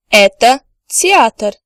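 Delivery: "Это театр" said slowly again; slow second "Это театр"; slow repeat. Shown as a demonstration. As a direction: repeat it for learners to copy. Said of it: "The answer could be".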